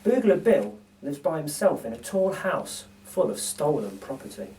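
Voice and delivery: plain reading voice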